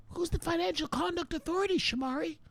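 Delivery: tiny, very high falsetto question; mock viewer voice. Viewer asking the streamer about the regulator, mock falsetto. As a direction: squeak it softly as an imitated viewer.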